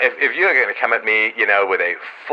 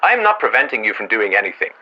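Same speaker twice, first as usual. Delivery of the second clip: usual speaking voice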